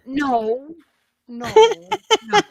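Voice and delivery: loud, high, spaced-out refusals; comic dread, each word its own cry; horrified denial. Retold or imitated. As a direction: wail each no in mock horror.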